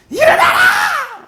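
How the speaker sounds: slow, very high, loud yell; long roaring wake-up cry; drawn-out shout. Shown as a demonstration. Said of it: "막 소리를 질러야지".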